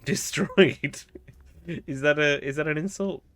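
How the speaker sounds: loud, high, amused outburst; laughing. Real, not acted. Player puzzled by the taught word, laughing. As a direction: ask it through real laughter.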